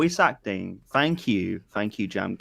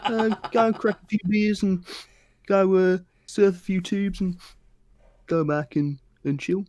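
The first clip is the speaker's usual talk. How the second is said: high laid-back Aussie surfer; easygoing Australian surfer dude; Australian surfer accent; 7 semitones higher than usual. Shown as a demonstration. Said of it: "You wanna do an Australian for us".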